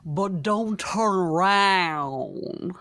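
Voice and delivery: slow, drawn-out Southern lilt; hammed-up Southern accent, very slow. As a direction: stretch it out in a lilting Southern drawl. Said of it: "say it in a Southern accent".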